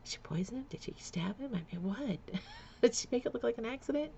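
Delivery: quick, hushed run of frightened guesses; racing thoughts. Retold or imitated. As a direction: rattle off the fears under your breath. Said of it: "I'm just like".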